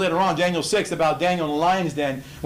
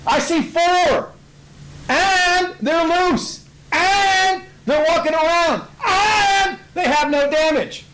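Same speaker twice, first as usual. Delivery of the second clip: much higher, louder and slow, in awe; amazed king; 13 semitones higher, 4 dB louder, slower than usual. Acted. Nebuchadnezzar tells counselors he sees four men, awed high slow voice. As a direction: stretch each phrase out in stunned wonder.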